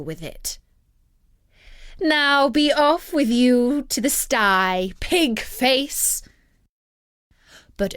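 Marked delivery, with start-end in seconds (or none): 2.0-6.2 higher, louder, slow sneering witch; witch's command. Acted. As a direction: order him off like a wicked witch. Witch commands after rapping wand.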